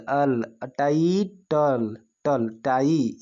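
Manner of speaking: टाइटल slowly split into टाई and टल; slow drawn-out syllables of टाइटल; sounding out syllables. Shown as a demonstration. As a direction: stretch each piece, अल, टल, टाई. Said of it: "ये बोले, क्या बोले".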